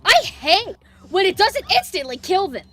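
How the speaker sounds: loud, high-pitched complaint; exasperated rant. Real, not acted. Player angry his rifle didn't kill, exasperated shout. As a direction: shout in disbelief at the weapon.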